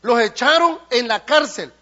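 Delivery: loud, driving pulpit preaching; fiery preaching. Acted. Preacher rousing the congregation, loud and driving. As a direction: preach it with full force.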